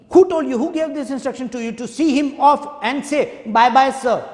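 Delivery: high, sharp furious questioning; angry demand. Acted. Governor grilling the officer, high and angry. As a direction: demand an answer, outraged.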